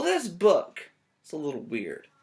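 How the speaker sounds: ordinary delivery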